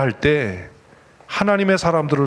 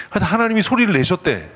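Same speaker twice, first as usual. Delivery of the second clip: normal talking voice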